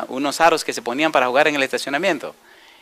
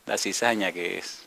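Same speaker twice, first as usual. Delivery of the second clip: lower, softer, slow repeat of "La cizaña"; 6 semitones lower, 8 dB quieter, slower than usual. Acted. Preacher repeating the answer quietly for weight, soft and slow. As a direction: drop the voice and let it sink in. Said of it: "¿qué es lo que miramos siempre".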